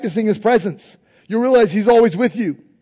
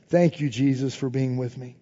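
low and much softer, intimate; soft prayer; 6 semitones lower, 6 dB quieter than usual. Acted. Preacher modelling prayer, soft hushed thanks. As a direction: drop to a hushed thank-you.

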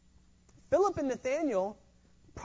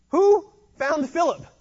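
loud, slow, pointed question; emphatic question; 6 semitones higher, 9 dB louder than usual. Real, not acted. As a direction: hit it hard and slow. Preacher's pointed rhetorical question.

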